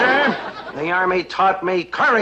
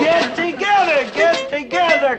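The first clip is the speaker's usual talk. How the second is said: calling out drill; 3 semitones higher, much slower than usual. Acted. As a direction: drill sergeant calling exercises to his recruits. Drill sergeant calling calisthenics.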